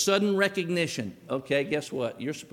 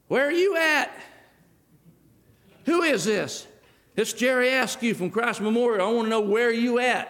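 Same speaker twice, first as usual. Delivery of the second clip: louder, higher demanding voice; demanding caller; 7 semitones higher, 3 dB louder than usual. Retold or imitated. Church member demanding on the phone, loud. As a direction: bark into the phone, impatient.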